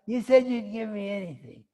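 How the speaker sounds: loud, high, mock-childish complaint; indignant protest. Prince reminding his father of the promise, indignant protest. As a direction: hold a parent to a promise, loudly.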